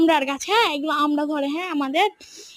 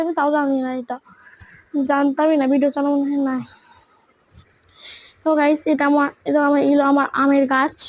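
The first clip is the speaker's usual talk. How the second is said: ordinary talk, nothing marked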